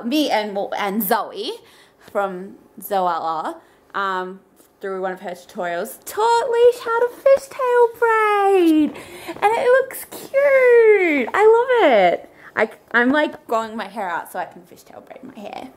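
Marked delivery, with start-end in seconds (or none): 6.1-12.3 gushing excitement. Real, not acted.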